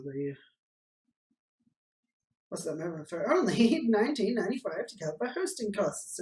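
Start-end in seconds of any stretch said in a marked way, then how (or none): 3.3-6.2 lightly raised, pompous alien pitch; a hint of a grandiose alien salesman. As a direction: sell the game like a grand envoy. Alien names the price.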